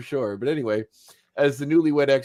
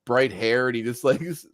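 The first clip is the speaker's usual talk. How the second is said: normal talking voice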